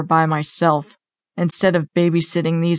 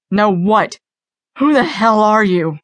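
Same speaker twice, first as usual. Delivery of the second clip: higher, slightly raised voice; tense, pitched-up questioning; agitated challenge; 4 semitones higher than usual. A narrator's reading. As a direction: demand to know who this is. Officer challenges the entity.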